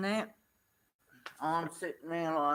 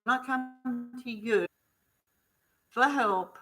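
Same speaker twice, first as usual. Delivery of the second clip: indignant complaint; 2 semitones higher, 4 dB louder than usual. Retold or imitated. Telling off the staff.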